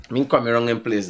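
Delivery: normal talking voice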